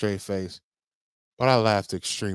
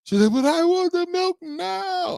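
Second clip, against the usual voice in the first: high, drawn-out mock tantrum wail; crying child whine. Dad imitating his daughter begging for milk, tearful high whine. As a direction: imitate a wailing kid stretching every word. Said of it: "starting to cry".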